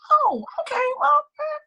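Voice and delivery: very high, hesitant little voice; imitated uncertain mom. Mom reacting to the news afterwards, high and hesitant. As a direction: mimic mom squeaking out an unsure reply.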